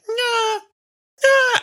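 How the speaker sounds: very high, rising squeals; mock squeals. Screams heard through the door, high comic squeals. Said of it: "It's like".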